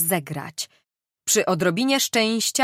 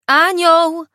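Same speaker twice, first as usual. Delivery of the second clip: shouting; 10 semitones higher, 10 dB louder than usual. Acted. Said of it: "Krzyknęła Gertruda".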